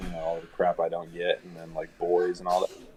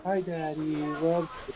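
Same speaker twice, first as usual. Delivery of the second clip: sweet daughter; 8 semitones higher, 5 dB quieter, much slower than usual. Retold or imitated. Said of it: "it's like".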